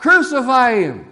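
high, very loud shout; crowd crying out. Acted. Same people turning on Jesus, full-force shout. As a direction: bellow it like a hostile mob. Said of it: "these same people are crying out".

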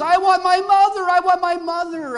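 put-on high, loud baby-bird cry; betrayed wail. Betrayed little bird crying for its mother, loud high wail. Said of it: "And feeling betrayed".

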